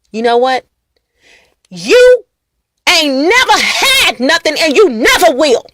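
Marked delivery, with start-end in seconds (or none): 1.7-5.6 higher and louder, stretched never; loud dramatic delivery. Shown as a demonstration. Acting the insult for viewers, loud and high. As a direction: belt it out with drama. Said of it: "How do you want me to say it".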